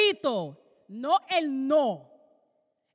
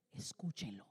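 hushed whisper from heaven; a whispered command; 19 dB quieter than usual. Acted. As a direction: breathe it out, barely voiced. God's voice from the cloud.

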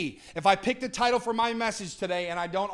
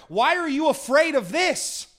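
higher, louder, slow voice of Jesus with authority; bold, lifted voice of Jesus; Jesus speaking; 8 semitones higher, 6 dB louder than usual. Retold or imitated. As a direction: proclaim it as Jesus, commanding but inviting. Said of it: "He was saying".

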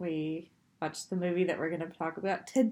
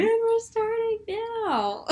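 laughing; 14 semitones higher, much slower than usual. Real, not acted. Teasing announcer voice, laughing.

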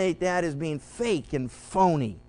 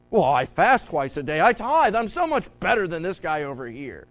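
pompous boasting louder voice; mocking a proud believer boasting; self-righteous mock; 3 semitones lower, 5 dB louder than usual. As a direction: play a smug hypocrite bragging.

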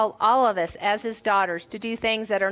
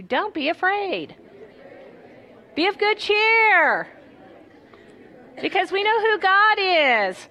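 encouraging call-out; 7 semitones higher than usual. Shown as a demonstration. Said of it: "Go ahead and, and remind the person next to you".

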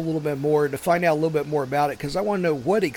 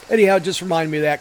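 ordinary talk

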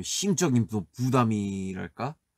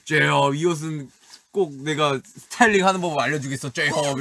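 loud, higher, mock-grand declaration; 진영 declaring; 5 semitones higher, 6 dB louder than usual. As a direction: proclaim it theatrically, hollering the name. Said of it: "이러면서". (형 흉내 내며 거창하게 선언)

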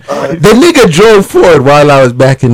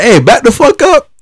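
higher, hammed-up back-off warning; retold warning; 9 semitones higher than usual. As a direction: push the guy back with a mock snap. Telling off the angry driver.

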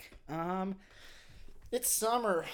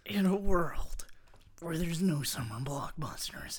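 strained trailer voice. Pretend. Co-host says he sounds strained, straining trailer voice. Another take of trailer intro.

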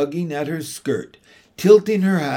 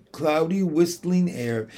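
plain reading voice

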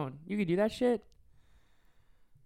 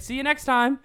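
cheery goodbye; 7 semitones higher, 6 dB louder than usual. Retold or imitated. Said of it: "be like".

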